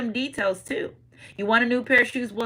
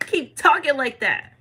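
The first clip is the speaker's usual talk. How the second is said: slower, somewhat raised voice; slow encouragement; 5 semitones higher, 3 dB louder than usual. Retold or imitated. Spirits egging on the bold believer, slow and approving. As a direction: draw out the encouragement. Same reply repeated in lower voice.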